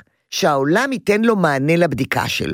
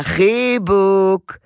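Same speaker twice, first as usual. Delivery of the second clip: drawn-out invitation; 4 semitones higher, much slower than usual. Shown as a demonstration. Mother slowly inviting her toddler to a hug. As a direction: stretch the word very slowly and warmly. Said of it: "תגיד לו כמו לשיה".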